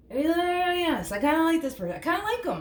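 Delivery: high-pitched gushing; giddy, high-voiced liking; excited approval. Real, not acted. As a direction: squeal your approval, high. Gushing over the person in the cards.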